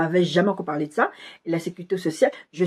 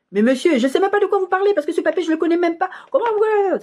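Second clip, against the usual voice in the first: much higher, heated protest; getting worked up; 11 semitones higher than usual. Pretend. Protesting to the advisor the way she might have, much higher and heated. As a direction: show how it would have escalated. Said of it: "si j'étais là en train de lui dire".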